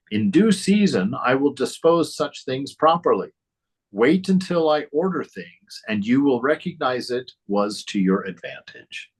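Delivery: high, lilting reading of sacred words; elevated devotional read-aloud; scripture reading. Acted. Reads scripture as Christ.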